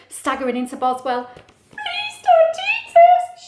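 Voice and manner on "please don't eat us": high, loud, slow tearful plea; hammed-up squeaky sob; mock sobbing. A narrator's reading. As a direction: wail it like a terrified storybook mouse. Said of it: "she sobbed".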